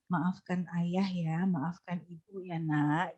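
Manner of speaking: whisper-gentle bedtime sorry; soft bedtime apology to a child; tender soft apology. Shown as a demonstration. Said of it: "sebelum tidur".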